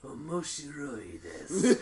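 slow, low anime villain drawl. Shown as a demonstration. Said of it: "that's my anime villain voice".

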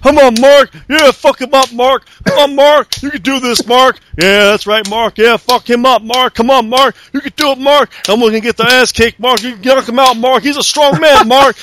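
imitating Big Show hollering for Mark from commentary; mock cheerleading Big Show. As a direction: egg Mark on like a rowdy fan. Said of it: "Big Show was on commentary going".

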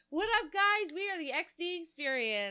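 excited intro. Acted. Show intro.

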